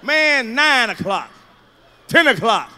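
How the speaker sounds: ordinary talk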